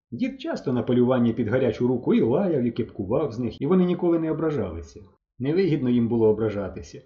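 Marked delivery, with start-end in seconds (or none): none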